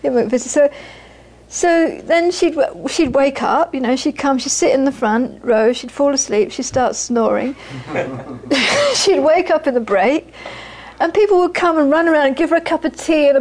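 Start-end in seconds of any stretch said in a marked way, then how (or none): none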